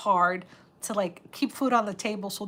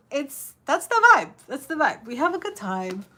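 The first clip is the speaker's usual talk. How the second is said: excited; 6 semitones higher, 7 dB louder than usual. Real, not acted. Excited reply to chat.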